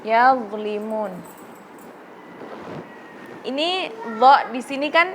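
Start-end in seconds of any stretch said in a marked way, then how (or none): 0.0-1.1 "yadlimun" slow, dha heavy and held without bounce; slow careful recitation; thick dha. Shown as a demonstration. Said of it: "ada dha".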